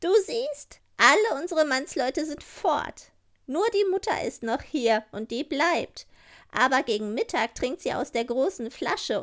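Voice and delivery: hoch und forsch, ein freches Räuberkind; wildes Räubermädchen. Acted. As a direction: keck und herrisch zu Gerda, höher als der Erzähler. Räubermädchen plant mit Gerda. (Räubermädchen redet forsch mit Gerda)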